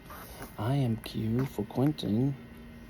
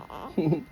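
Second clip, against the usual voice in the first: short amused laugh; 3 semitones higher than usual. Real, not acted. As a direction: laugh briefly at your own joke. Laughs at own accent.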